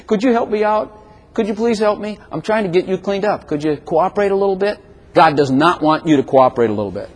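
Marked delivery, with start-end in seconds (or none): 0.1-4.8 higher, softer pleading voice of God; a mock-humble God asking for help; meek pleading God. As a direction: beg politely, almost timid. Preacher voices God pleading meekly.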